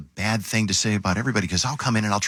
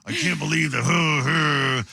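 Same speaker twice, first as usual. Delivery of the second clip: higher, ending in a mock shout; mock frustrated outburst; 7 semitones higher, slower than usual. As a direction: let it burst into a playful yell. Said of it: "She's like".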